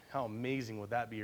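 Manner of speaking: ordinary delivery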